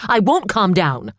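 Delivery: loud, slow, hitting won't; furious refusal. A narrator's reading. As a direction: spit out the refusal. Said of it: "she shrieked".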